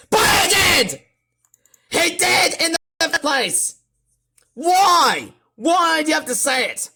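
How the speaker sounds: high, loud outraged shouting; extremely mad. Real, not acted. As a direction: protest in a raging yell. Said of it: "I'm sorry if I'm sounding so extremely mad".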